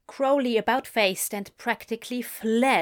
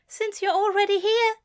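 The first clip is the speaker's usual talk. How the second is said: higher, fussy, pleading bookseller; high Aziraphale; 12 semitones higher, 5 dB louder than usual. Acted. Aziraphale calls after fleeing Crowley, high and plaintive. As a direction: call after him, a little plaintive.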